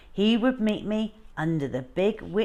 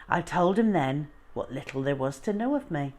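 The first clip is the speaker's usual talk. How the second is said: ordinary delivery, nothing marked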